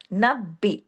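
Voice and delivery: नब्बी with the b pressed and held; firm doubled b. Shown as a demonstration. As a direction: lean hard into the b of नब्बी. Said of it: "सख्ती से जोड़ा है". (नब्बी, तशदीद सख्ती से)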